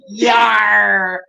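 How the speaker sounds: high, drawn-out pirate yar; mock pirate. Listener joking about pirate friends, hammed-up pirate voice. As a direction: throw in a hammed-up pirate cry.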